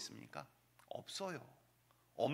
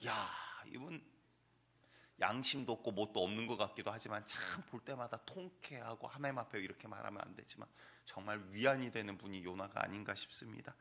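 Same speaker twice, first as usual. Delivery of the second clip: ordinary talk, nothing marked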